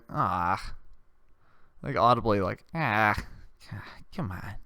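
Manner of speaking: put-on low disappointed groan of a let-down kid; audible groan. Kid groans at the cart of journals, low and let down. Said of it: "one guy's like, audibly".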